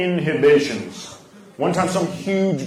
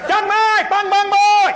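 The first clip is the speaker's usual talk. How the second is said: much higher, louder urgent mock-Vietnamese squawk; mock Vietnamese argument; 5 dB louder than usual. Vietnamese neighbors arguing, as a panicked mock-Vietnamese yell. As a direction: sound like an emergency in fake Vietnamese. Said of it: "Everything sounds like an emergency".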